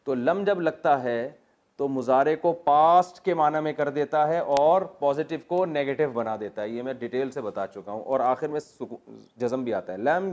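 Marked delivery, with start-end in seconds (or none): none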